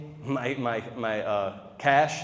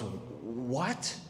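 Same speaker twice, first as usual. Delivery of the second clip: a hushed, disbelieving what, as if you're crazy; imitating Josh's flat stunned what; incredulous what; 11 dB quieter than usual. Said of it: "talk to you like you're fucking crazy".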